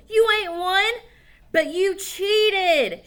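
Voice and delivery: high, loud, slow shout. Acted. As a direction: shout the accusation. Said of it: "shouted Tom".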